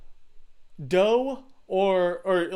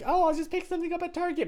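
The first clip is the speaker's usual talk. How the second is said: airy inner voice; 12 semitones higher, faster than usual. Retold or imitated. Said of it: "you're like".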